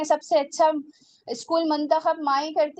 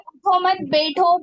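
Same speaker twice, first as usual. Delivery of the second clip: louder, higher, slow उठो मत, then बैठो; pause after मत; 4 semitones higher, 5 dB louder, slower than usual. Shown as a demonstration. Teacher stressing the order to sit, pause after मत. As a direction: press out उठो मत as one, set बैठो apart. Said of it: "बैठने की ताकीद".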